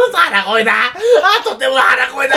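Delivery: high, laughing declaration; hammed-up gleeful shout; mock accusation. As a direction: crow the verdict through laughter.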